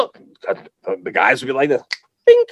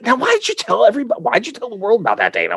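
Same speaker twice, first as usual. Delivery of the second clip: mock complaining husband; 7 semitones higher, faster than usual.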